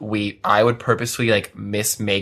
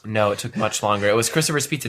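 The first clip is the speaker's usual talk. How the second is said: usual speaking voice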